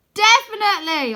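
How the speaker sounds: high, loud confident yell. Acted. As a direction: shout it back. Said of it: "I yell".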